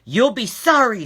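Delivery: loud, high furious threat; furious shark scream. Acted. Furious shark threatening the pigs, loud scream. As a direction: scream it at the house. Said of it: "screamed the furious magic shark in his loudest voice".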